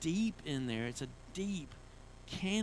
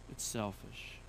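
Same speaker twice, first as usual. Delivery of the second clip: much lower and softer; hushed sober repeat; 8 semitones lower, 8 dB quieter than usual. Real, not acted. Preacher repeating the admission to the congregation, hushed and sober. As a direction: drop it low and quiet, letting it sink in.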